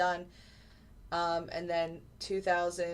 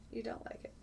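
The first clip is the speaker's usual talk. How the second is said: whispered; 3 semitones higher, 11 dB quieter, faster than usual. Real, not acted. Mock disappointment to viewer.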